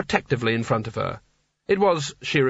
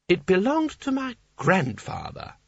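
put-on high, slow, wandering old ruler; dreamy old Seraph; 6 semitones higher than usual. A narrator's reading. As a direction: drift through the words like a tipsy old poet.

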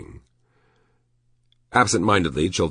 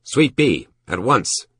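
curt, harsh order, pitched up; harshest voice; 8 semitones higher, 4 dB louder, slower than usual. A narrator's reading. Shopkeeper ordering the porter to the suite, harsh command. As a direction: demand it harshly and louder. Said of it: "said in his harshest voice".

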